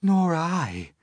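a hint of a high ladybird. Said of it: "the ladybird said".

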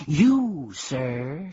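excited shout. Acted. Bee excitedly shouting at the humbug. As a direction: burst out at him. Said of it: "shouted the bee very excitedly".

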